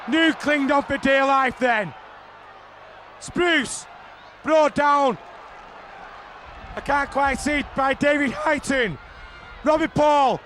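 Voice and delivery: usual speaking voice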